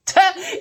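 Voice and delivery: sudden high giggle; delighted high-pitched laugh; high burst of laughter. Real, not acted. Laughs recalling dream.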